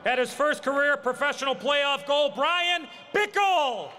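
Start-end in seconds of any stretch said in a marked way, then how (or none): 2.3-3.9 announcer call. Acted. Arena announcer calling player name.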